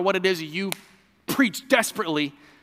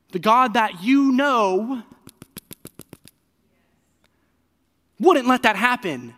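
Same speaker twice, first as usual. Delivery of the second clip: building preaching; 6 semitones higher, 6 dB louder than usual. Real, not acted. Preacher pressing the crowd, loud and rising. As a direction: drive it home to the congregation. Preacher driving the point home.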